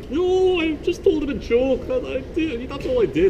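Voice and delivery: high pleading Scottish protest; jester protesting. Retold or imitated. Jester denying poisoning to the guards.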